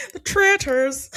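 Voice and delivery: The Traitors, hammed up in an Irish accent through laughter; giggling attempt at Maura's Irish The Traitors. Host retries Maura's Irish Traitors while laughing, mock Irish accent. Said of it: "Like Maura says it".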